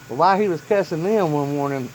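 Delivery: ordinary delivery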